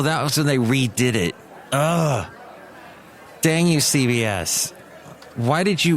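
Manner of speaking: ordinary delivery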